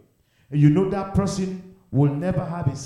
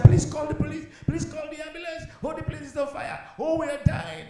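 much higher pitched mock panic; pretend cry for help; 12 semitones higher than usual. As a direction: act trapped in a burning church begging for help. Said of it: "I'm screaming and I'm shouting for help".

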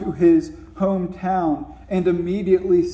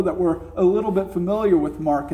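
ordinary talk, nothing marked

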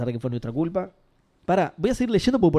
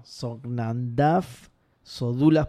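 very slow, deliberate reading; careful second stab at a tricky name; sounding it out; 3 semitones lower, much slower than usual. Real, not acted. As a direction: spell out the odd words bit by bit. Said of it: "es más jodido de leer".

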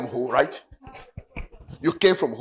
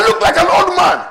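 higher mock voice with a laugh; mock vain man; 8 semitones higher, 4 dB louder than usual. Preacher mimics suitor, higher laughing complaint. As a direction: play the vain man worrying. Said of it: "So he said".

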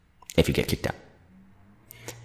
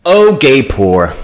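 mocking; 8 semitones higher, 7 dB louder, much slower than usual. Real, not acted. Mocking name pun.

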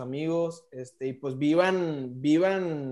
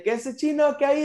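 excited greeting; 9 semitones higher than usual. Retold or imitated. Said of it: "fue la de el chino".

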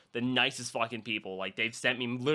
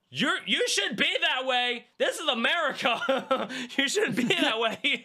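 high, slow, exaggerated scolding; mock indignant scold; 11 semitones higher, slower than usual. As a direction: play up outrage at a jackass, slowly and up high. Mocking rude people in stores.

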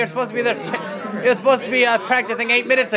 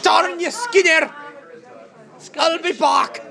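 mock villain shout; 7 semitones higher, slower than usual. Shaking a fist at Skinner, a hammed-up cartoon villain shout. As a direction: curse him like a cartoon villain.

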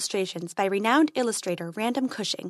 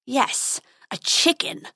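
snarling; 4 semitones higher, slower than usual. A narrator's reading. Dragon confirms his insult to the prince, snarling. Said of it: "snarled the dragon".

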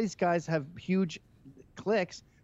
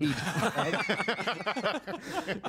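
real laughing burst; laughter; 3 semitones higher than usual. Real, not acted. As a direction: laugh it off heartily. Laughing at joke.

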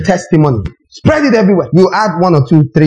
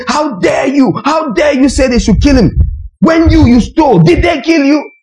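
animated rebuke; 8 semitones higher than usual. Retold or imitated. Preacher rebuking a crowd calling to burn a thief, outraged high shout. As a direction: thunder it at the mob like on that Sunday. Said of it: "I got very animated".

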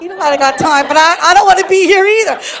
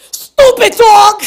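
higher, drawn-out mock yell; acted angry outburst; 5 semitones higher, 4 dB louder, slower than usual. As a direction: let the pent-up anger burst out, hammed up. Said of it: "watch what I do. I go".

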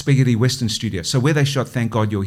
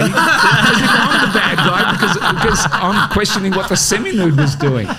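laughing; 7 semitones higher, 4 dB louder than usual. Real, not acted. Laughing at his own story.

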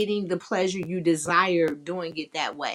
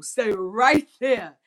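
slow, louder order to stay put; slow commanding hold; firm command; 4 semitones higher, 6 dB louder, much slower than usual. Shown as a demonstration. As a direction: pin them in place with your voice. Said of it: "learn how to just direct them".

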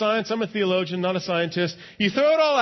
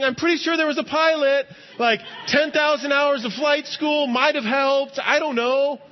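higher, dry, knowing put-on voice; skeptical passenger; 7 semitones higher than usual. Passenger mocking the prayer after landing, higher dry skeptic. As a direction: play the smug guy crediting the pilot. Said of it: "someone over here is going".

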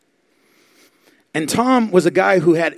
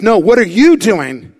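loud comeback; 5 semitones higher, 9 dB louder, slower than usual. Retold or imitated. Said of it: "and he says".

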